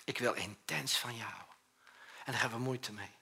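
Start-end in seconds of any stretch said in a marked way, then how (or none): 0.0-1.6 soft, tender declaration of love, voice dropped low; hushed, loving whisper-like vow; God's tender voice. Acted. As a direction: speak it gently as a promise. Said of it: "Maar hij zegt".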